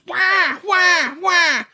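high blaring rabbit distress squeals; rabbit screaming. Retold or imitated. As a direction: blare out rabbit distress cries. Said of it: "boy, just blaring it".

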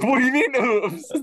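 outraged disbelief at oops; incredulous. Retold or imitated. Outraged at wife's casual oops.

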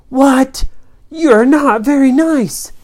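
high, loud protest; indignant outcry. Acted. Children protest to villain.

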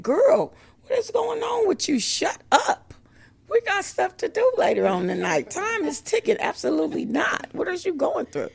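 high, loud, sassy voice of the flesh; sassy inner voice. Acted. Her flesh scolding her at the church door, a high, loud, sassy voice. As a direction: scold yourself like a bossy girlfriend. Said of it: "my flesh is saying".

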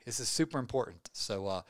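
ordinary talk

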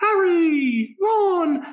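put-on very high, squeaky young girl voice; little girl voice. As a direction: pitch way up like an eleven-year-old girl. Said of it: "what Jim Dale did for Hermione ... a eleven-year-old girl voice".

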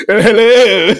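loud, high British hello through laughter; a silly British greeting, laughing; mock British accent. Host joking the player turned British, mock British accent. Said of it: "he started talking in a British accent".

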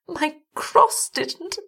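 high, very slow feeble voice; weakened woman. A narrator's reading. Weak wife doubting the garlic, slow and high. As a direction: draw it out high and weakly. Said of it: "She was weaker now".

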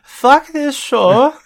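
high, slow, loud silly mock voice. Host echoing the angry listener in a goofy high voice.

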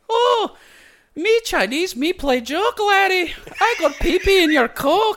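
very high, loud Irish-Scottish caricature; mock Irish accent. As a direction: mimic a bad Chinese accent that comes out Irish. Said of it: "your voice for that is literally just an Irish guy".

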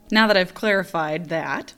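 usual speaking voice

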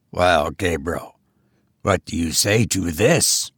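low, deliberate goblin voice; taunting goblin. A narrator's reading. Goblin grinning broader at the sexton, low and taunting. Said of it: "said the goblin".

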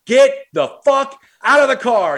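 high, loud burst of command; urgent yelled order. Retold or imitated. Ordering a buddy out of the unpaid cab, urgent yelled order. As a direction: yell it to get him moving now.

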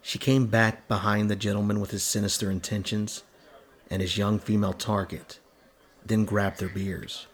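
ordinary delivery, nothing marked

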